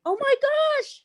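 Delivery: high, thrilled shriek of surprise; Oh my gosh squealed way up high; excited squeal. Real, not acted. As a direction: squeal with delight at the news. Overjoyed at winning free setup.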